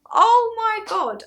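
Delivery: loud, high, drawn-out amazement; amazed exclamation. Retold or imitated. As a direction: cry it out slowly in astonishment. Retold shock, second take.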